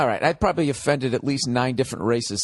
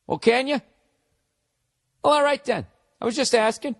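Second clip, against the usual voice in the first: mock innocence; 7 semitones higher than usual. Mocking a farmer's reaction.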